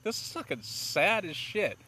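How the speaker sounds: high-voiced quote of own reaction; retold dismay. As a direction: squeal your dismay lightly. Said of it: "I was just like".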